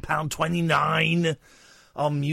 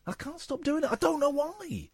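high, softer helpless whine; annoyed put-on voice; 10 semitones higher, 5 dB quieter than usual. Shown as a demonstration. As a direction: go high and small in mock exasperation. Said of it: "I'm doing that voice".

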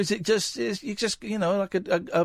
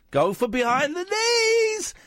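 squealing excited; slower than usual. Shown as a demonstration. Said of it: "and I go for behind the knees".

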